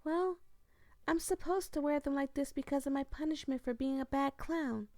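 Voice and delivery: put-on high, timid clown girl voice; Lala's high voice. Streamer voicing Lala to the player, high timid voice.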